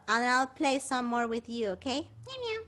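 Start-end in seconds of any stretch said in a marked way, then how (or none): 2.3-2.6 high, soft little meows. Pretend. Meowing to the kitten before saying goodbye. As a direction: meow gently like a kitten.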